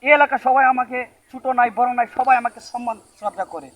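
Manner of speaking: loud, pompous wizard voice; puffed-up showman magician; boastful magician. Acted. Plays a boastful Uganda magician.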